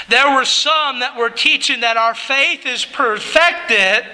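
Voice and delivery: normal talking voice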